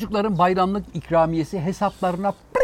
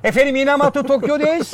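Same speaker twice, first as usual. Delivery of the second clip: announcer voice; 9 semitones higher than usual. Acted. Mock show opening announcement.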